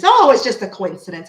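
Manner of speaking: loud, dismissive mock quote; mocking imitation. Reader mimicking closed-minded people's excuse, loud mocking. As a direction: parody a dismissive skeptic, loudly.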